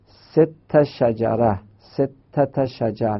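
ست شجره read very slowly; slow example reading. Shown as a demonstration. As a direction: spell out ستت شجر slowly. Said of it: "مثلاً". (آهسته خواندن مثال)

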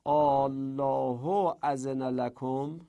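الله اذن لکم slowly, with a long opening ā; very drawn-out Āllah in الله اذن لکم; elongated Quranic reading. Shown as a demonstration. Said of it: "مثلاً تو قرآن هستش که".

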